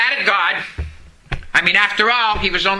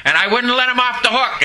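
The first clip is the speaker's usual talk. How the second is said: ordinary talk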